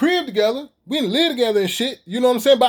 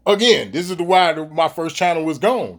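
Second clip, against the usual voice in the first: ordinary talk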